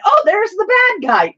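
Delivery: high, bright mom voice; mother voice. Retold or imitated. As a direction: play the clueless mom. Mom voiced in Doctor Who story.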